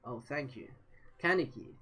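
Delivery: ordinary delivery, nothing marked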